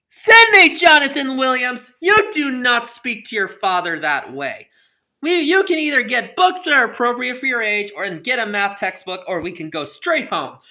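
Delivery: loud, high angry dad voice; stern father scolding. Acted. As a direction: scold the boy sharply and loudly. Father scolding son at library.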